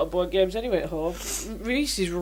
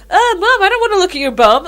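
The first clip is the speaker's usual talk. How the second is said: put-on much higher and louder whining kid; whiny child voice; 12 semitones higher, 10 dB louder than usual. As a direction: whine like a five-year-old. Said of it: "he started going".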